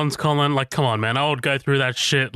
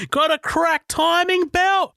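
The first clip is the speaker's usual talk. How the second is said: high, slow broad Australian bogan shout; bogan Australian accent; slower than usual. Retold or imitated. Host mimicking the Australian bogan mechanic, high broad Australian accent. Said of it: "it's so bogan".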